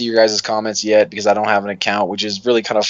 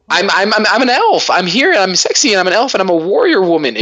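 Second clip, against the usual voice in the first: high, loud mock of a self-proclaimed elf warrior; mocking elf woman; 10 semitones higher, 3 dB louder than usual. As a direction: ham up a smug cosplayer.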